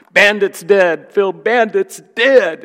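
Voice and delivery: high, loud, drawn-out mock wail; acted-out tearful cry, slow and high; imitated crying. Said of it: "he came running in crying".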